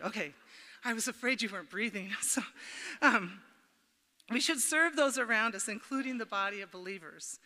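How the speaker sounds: ordinary talk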